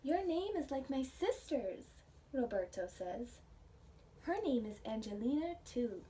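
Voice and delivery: gentle storybook reading with hushed whispered stretches; reading to children. Acted. Teacher reading a picture book aloud to her class.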